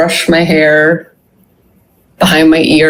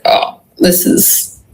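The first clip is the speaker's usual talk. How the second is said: mock groan; 6 semitones higher, slower than usual. Returning to body, groaning.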